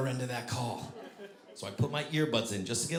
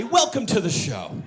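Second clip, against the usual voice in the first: showman welcome; 7 semitones higher, 6 dB louder than usual. Retold or imitated. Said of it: "as if to say".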